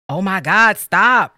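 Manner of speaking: a loud exasperated stop; retold yell. Husband yelling stop at wife getting in the car. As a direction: yell it like a fed-up partner. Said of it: "you were like".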